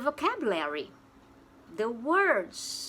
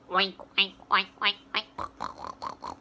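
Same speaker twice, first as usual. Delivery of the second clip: oink like a pig; imitated pig oink; 5 semitones lower than usual. Shown as a demonstration. Pig sound demo.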